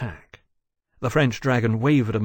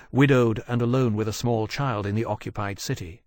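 usual speaking voice

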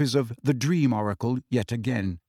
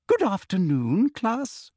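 high, sugary sing-song teacher; sickly sweet greeting; Umbridge; 8 semitones higher, slower than usual. A narrator's reading. Umbridge greeting her class, high and sugary.

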